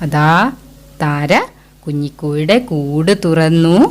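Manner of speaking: teacher narrating the hen story to the class; story narration. Acted.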